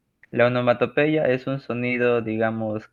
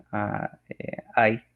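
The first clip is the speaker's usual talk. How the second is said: pained groans; much slower than usual. Shown as a demonstration. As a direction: groan "ah, eh, ay" as if hurt. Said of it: "cuando tienes dolor".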